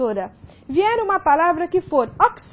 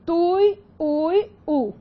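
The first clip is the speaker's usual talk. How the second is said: "tui, ui, u" devagar, sílaba por sílaba; separação silábica; much slower than usual. Shown as a demonstration. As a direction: separe "tui, ui, u" com uma pausa entre as partes. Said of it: "Então, você separa". ("tui, ui, u" escandido, bem pausado)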